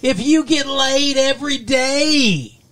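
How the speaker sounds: high, loud, very slow comedian setup; hammed-up joke setup. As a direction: stretch the setup out like a ham comic.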